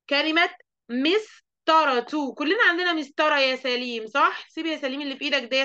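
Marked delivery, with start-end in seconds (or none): 0.1-2.3 word drawn out slowly for the class; slow teacher dictation. Shown as a demonstration.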